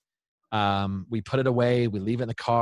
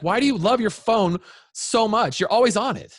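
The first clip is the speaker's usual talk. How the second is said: imitated upset kid, high-pitched and louder; accusing child; 11 semitones higher, 4 dB louder than usual. Daughter confronting dad about his phone, retold high accusation. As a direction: re-enact a child's insistent complaint.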